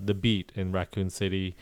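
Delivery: usual speaking voice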